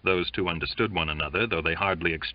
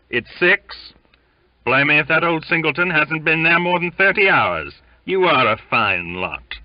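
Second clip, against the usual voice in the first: high, loud bosun's rebuke; shouted scolding; 8 semitones higher, 7 dB louder than usual. A narrator's reading. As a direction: berate the crew at a shout. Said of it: "he shouted inside".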